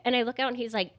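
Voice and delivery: ordinary delivery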